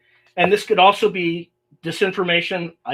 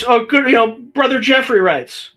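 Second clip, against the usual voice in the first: ordinary talk, nothing marked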